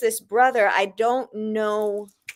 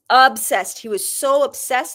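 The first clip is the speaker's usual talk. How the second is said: OBSESSED hit higher and louder; raised pitch driving home "obsessed"; emphatic repeat; 4 semitones higher, 4 dB louder than usual. Real, not acted.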